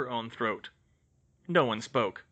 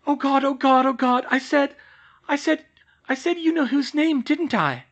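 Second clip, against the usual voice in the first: high, loud rising panic; young witch horrified at her own mistake; panicked; 9 dB louder than usual. Acted. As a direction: spiral into horrified realisation. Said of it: "her heart started racing".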